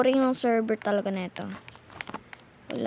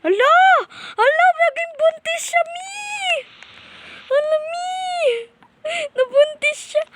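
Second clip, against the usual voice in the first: very high, loud shocked squeal; shocked shriek; 10 dB louder than usual. Real, not acted. Gamer discovering the character is pregnant, shrieking in shock. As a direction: shriek in disbelief.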